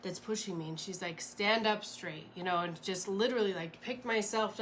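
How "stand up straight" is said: ordinary talk, nothing marked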